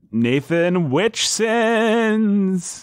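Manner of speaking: slow, high, drawn-out spooky intoning. Acted. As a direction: intone it like a ghost. Spooky donor name call-out.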